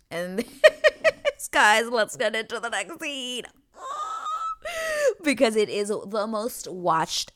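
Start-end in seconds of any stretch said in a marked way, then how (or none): 0.5-1.4 squealing burst of laughter; high giggle. Real, not acted. Vlogger cracking up mid-story, high giggle. 3.8-5.2 high, drawn-out squeal of laughter. Real, not acted. Vlogger cracking up about the show, long high laugh.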